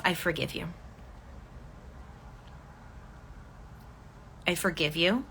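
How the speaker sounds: soft and quiet, like a private inner thought; gentle self-forgiveness. Shown as a demonstration. Coach models a quiet inner affirmation for listeners, softly. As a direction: say it gently to yourself, barely aloud. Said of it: "internally said to yourself".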